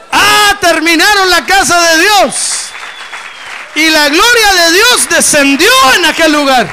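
loud, high, slow preacher's cry of glory; fiery revival preaching, voice ringing out; full-force preaching. Acted. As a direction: lift the church with a soaring shout. Preaching climax, glory to God.

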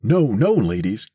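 high, loud protest that drops back; alarmed cry. A narrator's reading. Mister Thurkell tries to halt the rushing ladies, crying out. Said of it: "cried Mister Thurkell, leaping about".

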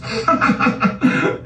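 high wheezing giggle; mocking laughter. Real, not acted. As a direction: crack up at the boast. Ridiculing viewer's house.

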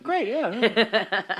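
high, slow, laughing put-on voice; slow laughing drawl. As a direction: drag it out with a laugh. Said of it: "I'll be like".

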